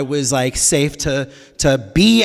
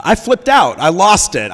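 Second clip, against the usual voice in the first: worked-up confession; 5 semitones higher, 5 dB louder than usual. Real, not acted. Comic confession of losing control.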